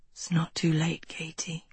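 soft, unvoiced low whisper. A narrator's reading. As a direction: whisper it close, barely breathing. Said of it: "he whispered".

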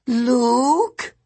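a fragile mother calling from the kitchen. A narrator's reading. May calling to her son from the kitchen, high and thin. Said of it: "May Castellan called".

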